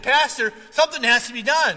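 urgent deacon plea. Retold or imitated. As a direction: press the pastor hard, loud.

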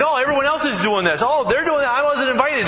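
high, whiny mock complaint; whiny envious voice. Preacher mocking the envious social media user, high whine. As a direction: mimic someone feeling left out.

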